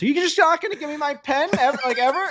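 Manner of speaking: high mock-wailing kid voice; kid calling out. Golfer imitating the kid yelling from the bridge, high and wailing. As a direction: call after them like a hurt little boy. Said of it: "he's screaming to us".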